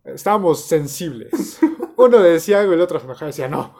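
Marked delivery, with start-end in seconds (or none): none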